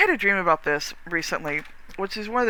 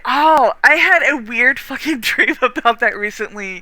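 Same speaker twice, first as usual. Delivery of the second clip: laughing; 4 semitones higher, 8 dB louder than usual. Real, not acted. Laughing while recounting dream.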